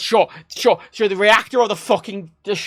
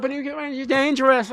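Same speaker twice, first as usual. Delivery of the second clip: higher-pitched imitation of a worried objector; mock worrier; 7 semitones higher than usual. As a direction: mimic an objection in a high voice. Voicing nuclear sceptics in an argument.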